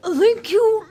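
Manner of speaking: high, loud ringmaster welcome; showman. Acted. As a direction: greet the crowd like a ringmaster. Ringmaster greets crowd.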